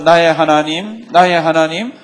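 very loud, slow scripture recitation; crying out loudly. Acted. Preacher reads Jesus' cry with congregation, loud and drawn out. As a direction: proclaim each phrase, stretched and loud. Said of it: "예수께서 크게 소리 질러 가라사대".

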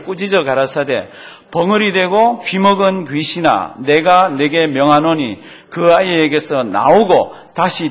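loud, slow unison reading; group scripture reading. Real, not acted. Pastor and congregation reading the rebuke loudly. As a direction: read the rebuke out together.

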